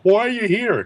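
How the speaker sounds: high, squeaky puzzled conductor; a baffled train conductor calling out. Retold or imitated. Conductor looking at them.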